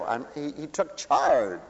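normal talking voice

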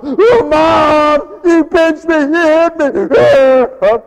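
put-on high, loud whining kid; mock kid crying to Mom; whiny child tattling.

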